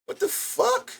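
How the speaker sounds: high cracked surprise; floored by the donations; disbelief. Real, not acted. Streamer seeing a hundred goal cleared, high stunned yelp.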